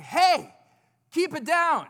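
high, loud call of hey, keep it down; loud shush. Retold or imitated. Dad telling loud kids to hush, high and loud. Parent scolding noisy kids.

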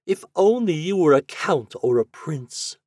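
high, lightly wistful lady's voice; sighing lady. A narrator's reading. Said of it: "she sighed".

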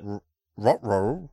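a hint of Scooby saying uh-oh; Scooby-Doo voice. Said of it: "Scooby-Doo".

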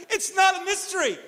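high, loud insistence; emphatic insistence. Real, not acted. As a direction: insist as if it's obvious. Preacher insists the answer is obvious.

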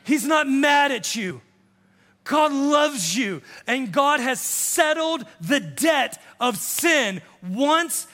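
slow preacher's shout of good news; full force preaching. Acted. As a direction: proclaim it over the crowd.

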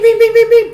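high, loud mock gunfire noises; imitated gunshots. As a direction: fire off quick shots with the voice.

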